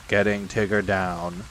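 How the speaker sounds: low, slow donkey drone; gloomy Eeyore. Acted. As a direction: spell it out low and slowly. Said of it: "said Eeyore".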